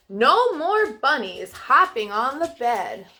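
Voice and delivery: normal talking voice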